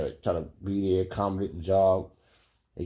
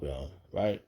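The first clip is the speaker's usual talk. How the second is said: normal talking voice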